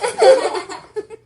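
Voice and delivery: loud high mocking laugh. A narrator's reading. Buster laughing at Carla, mocking. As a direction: laugh at her.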